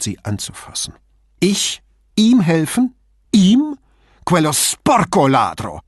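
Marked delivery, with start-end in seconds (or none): 1.4-5.8 high, loud spitting outburst; furious hissing. Acted. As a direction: spit the words out in rage. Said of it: "fauchte sie mit hochrotem Gesicht".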